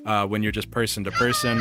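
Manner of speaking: ordinary talk, nothing marked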